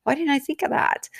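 lightly imitating a student's high, surprised realization; mock surprise. Dog trainer imitating her class students, high mock surprise. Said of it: "they're like".

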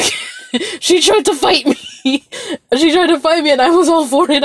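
cracking up at the memory of the fight; giggling while telling how she tried to fight me; laughing. Real, not acted.

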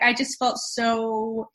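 ordinary delivery

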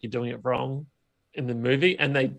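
usual speaking voice

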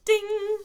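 high loud ding; imitated bell ding. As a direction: chime like a school bell. Bell rings.

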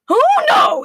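loud, high, alarmed wail; crying out. A narrator's reading. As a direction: cry out in horror. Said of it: "he cried".

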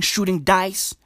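ordinary talk

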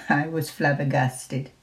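usual speaking voice

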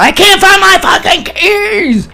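high, drawn-out frantic scream; screaming and yelling. Retold or imitated. Re-enacting screaming at mom over lost keys, frantic yell. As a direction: scream it out, stretching every word. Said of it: "screaming and yelling".

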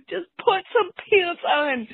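near tears. Real, not acted. Frustrated mom begging son.

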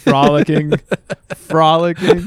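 mocking. Real, not acted. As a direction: ham up the word frolicking.

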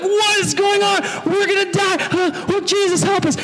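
very high, panicked mock shouting; hammed-up terror, crying out for rescue; freaking out. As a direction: act like a sailor sure the boat is sinking. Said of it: "the disciples start freaking out".

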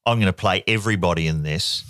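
ordinary talk, nothing marked

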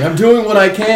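hammed-up hero under pressure; strained protest. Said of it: "Gray's like".

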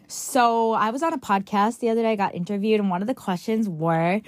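ordinary talk

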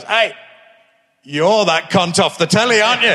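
high, loud heckle imitating a Liverpudlian; rough attempt at a Scouse passer-by; mock Scouser. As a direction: shout it out like a Scouser, accent only attempted. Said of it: "a proper Scouser came down. I can't do a Liverpool accent".

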